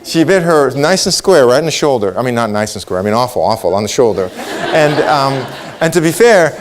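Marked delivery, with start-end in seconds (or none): none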